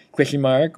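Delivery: "question mark" run together and slurred; rushed, slurred "question mark"; weird slurred delivery. Shown as a demonstration. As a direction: blur the sounds of "question mark" into a quick mush. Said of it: "say it in a weird way".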